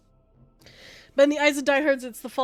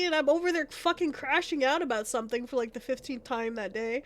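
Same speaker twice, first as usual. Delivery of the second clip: ordinary delivery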